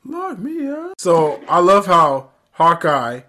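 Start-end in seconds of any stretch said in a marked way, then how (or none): 0.1-0.9 very high, soft falsetto; playful high-pitched begging, softly; mock swooning plea. Joking plea to be jailed.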